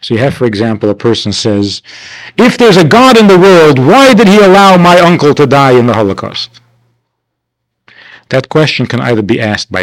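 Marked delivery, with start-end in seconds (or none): 2.4-6.6 much higher and louder cry; anguished challenge. Retold or imitated. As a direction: demand an answer in grief. Grieving person challenging God.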